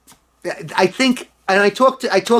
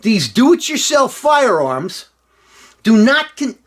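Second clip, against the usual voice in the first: higher, slow New York politician voice; slow pitched-up Cuomo impression; Cuomo accent; 7 semitones higher, slower than usual. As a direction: start a mock Cuomo read. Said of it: "Cuomo continued".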